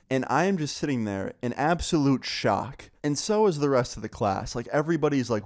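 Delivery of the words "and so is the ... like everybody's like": plain reading voice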